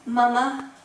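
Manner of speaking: put-on high-pitched child calling for his mother; young son calling. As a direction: imitate a little boy seeking Mama's attention. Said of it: "my son, would say".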